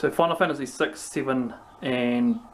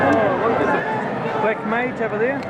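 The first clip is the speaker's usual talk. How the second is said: excited; 8 semitones higher, 4 dB louder than usual. Real, not acted.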